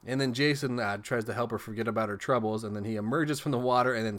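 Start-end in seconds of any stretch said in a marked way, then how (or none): none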